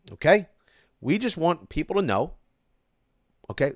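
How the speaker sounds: usual speaking voice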